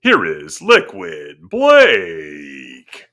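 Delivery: slow booming boxing announcer; ring announcer. Pretend. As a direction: draw it out like a fight intro.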